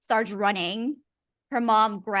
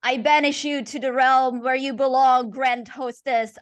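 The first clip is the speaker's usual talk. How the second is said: raised, forceful exorcism command; priest's banishing cry; 5 semitones higher, 8 dB louder than usual. Acted. Priest banishing the Grand Hostess, forceful cry. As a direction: cast the demon out with full conviction. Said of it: "and then says".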